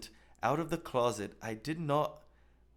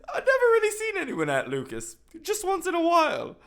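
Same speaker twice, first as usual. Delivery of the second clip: high, loud, sarcastic reading of the quote; incredulous mock reading; 7 dB louder than usual. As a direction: read it back to ridicule it. Mocking re-read of the quote.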